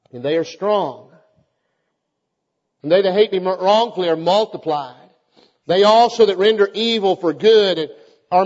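a psalm read aloud, rising to a plea; scripture reading. Acted. Preacher reading Psalm thirty-eight to the church, solemn. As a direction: read the psalm as a prayer to the Lord.

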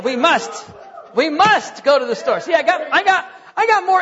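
rally the room with a mock-urgent cry; hammed-up urgent ice cream call; playful exclaim. Joking about ice cream.

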